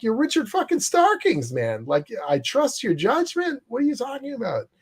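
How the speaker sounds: high, incredulous reply. Retold or imitated. Jim telling Richard to trust himself, high and incredulous. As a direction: raise the voice in disbelief.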